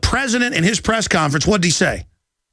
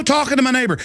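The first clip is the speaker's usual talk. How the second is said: usual speaking voice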